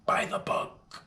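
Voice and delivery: hushed whisper closing the point. Real, not acted. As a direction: whisper "by the book" under the breath. Whispered closing to the clean-play line.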